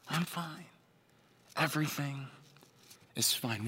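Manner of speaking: soft, hollow reassurance; quiet fake fine. Preacher voicing a hurting person's front, soft and hollow. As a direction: say you're fine while falling apart. Front while crumbling inside.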